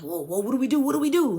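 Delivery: mock the men's frantic panic; panicky. The men bursting into the house, panicking, climbing high and loud.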